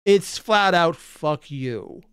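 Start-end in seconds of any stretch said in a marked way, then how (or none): none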